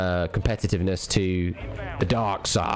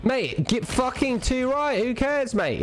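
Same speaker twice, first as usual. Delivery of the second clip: much higher, slow and exaggerated; mock excited; 13 semitones higher, slower than usual. Streamer hams up reaction to girlfriend's pictures, high. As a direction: ham it up in a high voice.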